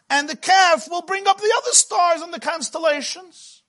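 high, excited arguing voice, lots of pitch leaps; eager Moshe pleading. Acted. Moshe arguing to God for the calf, high and eager. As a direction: sell the calf to God like a salesman.

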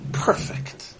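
hushed, breathy one-word reply; Tony's reply. Retold or imitated. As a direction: drop to a near-whisper for Tony. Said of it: "He said".

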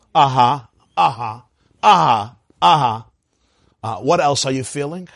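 low, repeated mock listening noises; therapist. Therapist nodding a client along every ten minutes, mock-attentive. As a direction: mimic a therapist going through the motions. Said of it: "there are such therapists".

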